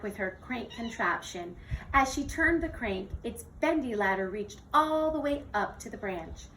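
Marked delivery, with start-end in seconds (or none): none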